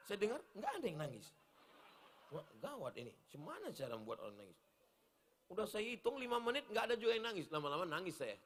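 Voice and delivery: usual speaking voice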